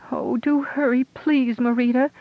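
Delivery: high, loud wailing plea. Acted. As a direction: wail it out, begging. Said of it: "Diona's plea was more wail".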